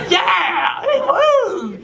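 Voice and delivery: very high woo; squealing cheer; God's whoop. Retold or imitated. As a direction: cheer like the bro God. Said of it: "God talks like that".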